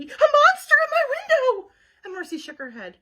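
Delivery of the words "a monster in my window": terrified shout. Acted. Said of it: "shouted Baby".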